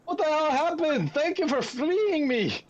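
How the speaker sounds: very high-pitched, would-be Irish dwarf; high, strained dwarf with a shaky Irish lilt; dwarf accent. Acted. Game master voices a freed dwarf gasping thanks, high dwarf accent. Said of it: "Your Irish accent needs help".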